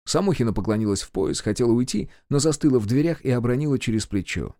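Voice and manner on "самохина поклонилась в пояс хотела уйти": ordinary delivery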